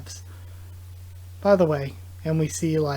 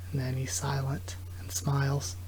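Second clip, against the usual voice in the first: soft whispered narration; 3 semitones lower, 9 dB quieter than usual. Real, not acted. Reader narrating the silent moment, whispered. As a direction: breathe it out, barely voiced.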